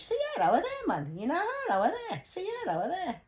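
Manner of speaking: loud high Geordie call of Sierra; Geordie accent. Shown as a demonstration. Doing Geordie voice calling to Sierra, loud. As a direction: yell it like a Geordie lad. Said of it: "In a Geordie language".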